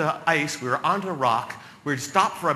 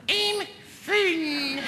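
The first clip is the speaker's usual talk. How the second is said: very high, strained falsetto squeal; squeaky yell; 13 semitones higher than usual. Acted. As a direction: squeak it out as if dangling by the groin. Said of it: "so what you yell is".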